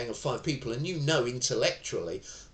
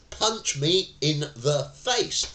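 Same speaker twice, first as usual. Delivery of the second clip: higher, louder, slow, "face" rising; what they hear; 6 semitones higher, 6 dB louder, slower than usual. Shown as a demonstration. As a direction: let it land like an invitation. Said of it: "what they actually hear".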